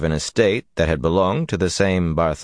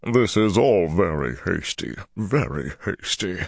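ponderous Ogier elder, words like gravel sliding; slow gravelly tones. A narrator's reading. Ogier elder fretting at being hurried, slow gravelly tones. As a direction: speak slowly, like gravel sliding. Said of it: "said in slow tones like gravel sliding".

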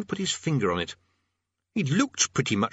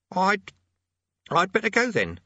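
put-on high teenage boy voice; 11 semitones higher, 3 dB louder than usual. A narrator's reading. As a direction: play a nervous young boy.

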